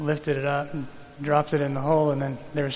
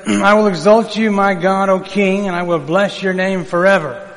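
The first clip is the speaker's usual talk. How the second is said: raised, louder psalm reading; scripture reading; 4 semitones higher, 6 dB louder than usual. Acted. Pastor reads the psalm to his congregation, proclaiming.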